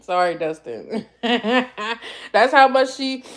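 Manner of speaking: laughing through tears. Real, not acted. Laughing through tears after apology.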